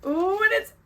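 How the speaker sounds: high, loud squeal of joy; thrilled yell at a pink gift; excited shout. Real, not acted. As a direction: shriek with delight at the colour. Unwrapping the pink pillow.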